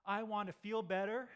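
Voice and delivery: high, loud, slow craving whine; loud mock plea; pleading. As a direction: beg for relief. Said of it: "And we're like".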